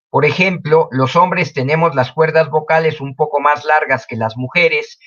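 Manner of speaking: ordinary talk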